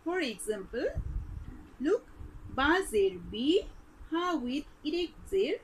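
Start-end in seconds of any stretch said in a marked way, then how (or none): none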